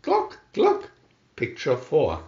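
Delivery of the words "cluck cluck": high hen cluck. A narrator's reading. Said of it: "said the red hen".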